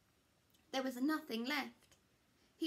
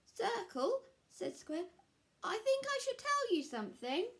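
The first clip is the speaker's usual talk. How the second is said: much higher, louder nervous confession; nervous Square; 12 semitones higher, 5 dB louder than usual. Acted. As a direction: call her back, anxious to confess.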